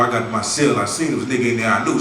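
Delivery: usual speaking voice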